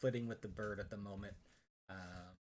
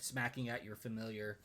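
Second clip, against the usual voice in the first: ordinary talk, nothing marked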